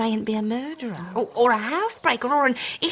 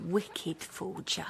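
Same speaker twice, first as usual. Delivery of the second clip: 1 hushed menace; 3 semitones lower, 12 dB quieter, much slower than usual. Acted. Mother-in-law slandering the husband.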